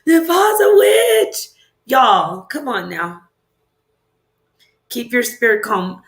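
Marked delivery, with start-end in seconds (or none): 0.1-1.5 high, slow gasp of fake alarm; mock shocked viewer. Streamer mimicking viewers calling her a witch, mock shocked. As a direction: play a scandalised viewer, drawn out. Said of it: "people are like".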